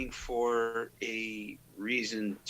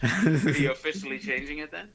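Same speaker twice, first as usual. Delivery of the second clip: laughing; 6 semitones higher, faster than usual. Real, not acted. Laughing question.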